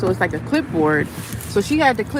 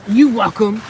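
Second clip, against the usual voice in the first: imitating snippy coworker; 8 dB louder, slower than usual. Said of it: "So she was like".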